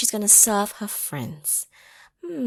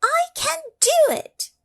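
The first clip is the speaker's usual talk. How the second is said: very high, louder little voice; high determined Mabel; 6 dB louder than usual. Acted. Mabel telling her friends she can, high and bright. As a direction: chirp it out with fierce resolve. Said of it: "And Mabel is saying".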